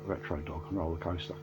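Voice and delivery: embarrassed muttered correction of Retro Dog; quiet correction. Real, not acted. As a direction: mutter the right title to yourself. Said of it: "not Robo Dog, what am I about?".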